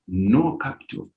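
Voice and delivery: normal talking voice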